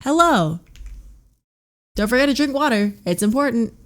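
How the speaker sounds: sing-song reminder. Real, not acted. Talking to pet or viewers.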